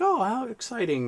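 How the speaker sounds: high-pitched imitation of a friendly Australian woman; imitated Australian lady. Concierge reacting to his birthday, imitated high-pitched Australian lady. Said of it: "this beautiful Australian lady".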